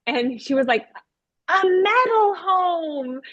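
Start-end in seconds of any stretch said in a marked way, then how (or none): 1.5-3.2 put-on high, drawn-out child's answer; eager child. Pupil answering the robot puppet, high eager child voice. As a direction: sing out the idea slowly like a keen pupil.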